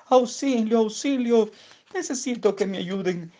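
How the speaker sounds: sehr hoher, lauter ängstlicher Ruf; Hilferuf. Acted. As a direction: schrei verängstigt um Hilfe. Vögelchen in Gefahr ruft.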